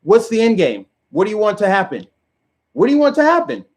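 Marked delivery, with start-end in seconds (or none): 2.7-3.6 pitched much higher, rising at the end; incredulous rising repeat. Shown as a demonstration. Demanding an answer from liberals, higher and incredulous. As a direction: throw the question up in disbelief. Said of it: "repeated question, second higher".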